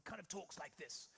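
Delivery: put-on hushed old army brigadier voice. Flying instructor introduced as an old army type, brigadier impression. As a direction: talk like an old explorer-brigadier, softly. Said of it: "he looks like a brigadier".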